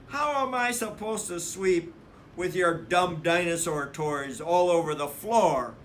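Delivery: cross little sister; arguing. Acted. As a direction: complain at your brother, fed up. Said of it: "argued Sister".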